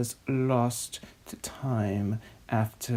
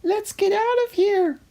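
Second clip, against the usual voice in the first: very high, louder frightened cry; panicked shout; 4 dB louder than usual. Acted. Blaze fleeing the evil laugh in a high panicked cry. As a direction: flee in mock panic.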